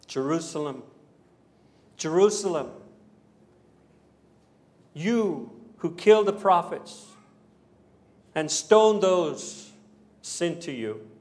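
understated weeping plea, heavy and deliberate; sorrowful lament. Acted. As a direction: mourn over them, drawing out each phrase. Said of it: "Jesus was weeping over Jerusalem".

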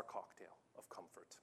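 breathy confiding whisper; hushed aside to the congregation. Acted. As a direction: let it drop to a secret whisper. Aside after preaching point.

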